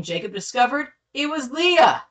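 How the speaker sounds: loud, high-pitched dramatic revelation; storyteller's startled exclamation; shocked reveal. A narrator's reading. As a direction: land the twist with astonishment.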